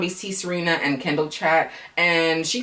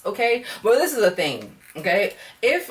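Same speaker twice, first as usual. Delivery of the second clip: normal talking voice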